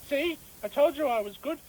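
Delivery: ordinary talk, nothing marked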